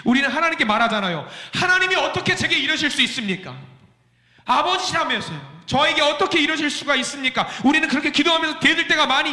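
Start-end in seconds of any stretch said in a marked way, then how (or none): 1.5-7.5 indignant protest. Acted. Believer protesting to God.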